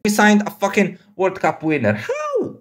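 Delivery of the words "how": sudden very high pitched how; a short high squeak of disbelief; incredulous squeak. Real, not acted. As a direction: yelp it out in disbelief. Disbelief at World Cup claim.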